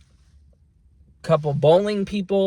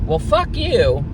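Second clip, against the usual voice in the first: mock fuck you; 11 semitones higher, 4 dB louder, slower than usual. Venting about ungrateful coworker.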